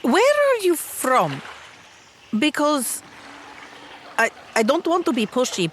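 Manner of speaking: normal talking voice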